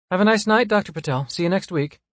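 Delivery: high, loud cheerful goodbye; bright female farewell. Acted. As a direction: say goodbye sweetly.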